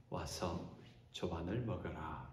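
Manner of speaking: soft, slow and tender call to breakfast; literary gentle invitation. Acted. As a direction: invite them in softly, like a kind host. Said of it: "이렇게 문학적으로". (부드럽고 느린 문어체 초대)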